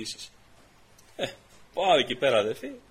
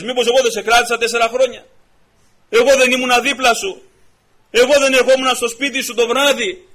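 loud, rising fervent sermon cry; preaching at full force; 10 semitones higher, 14 dB louder than usual. Acted. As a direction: preach it out, pushing louder line by line.

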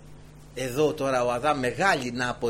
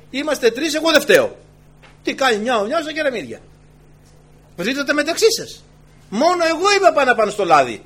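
loud, high preaching; fiery preaching; 5 semitones higher, 6 dB louder than usual. Acted. Preacher urges sinners to repent, at full force.

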